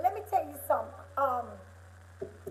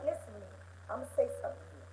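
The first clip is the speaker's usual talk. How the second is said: ordinary delivery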